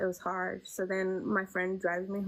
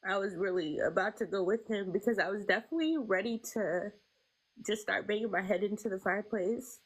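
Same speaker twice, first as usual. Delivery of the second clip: fighting back tears; 4 semitones higher than usual. Real, not acted. Recalling her baby's death.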